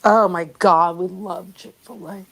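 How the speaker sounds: usual speaking voice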